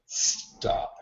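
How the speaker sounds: hushed urgent whisper; whispered warning backstage; whispered stop. Retold or imitated. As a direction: warn them under your breath. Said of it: "I tell everybody around me".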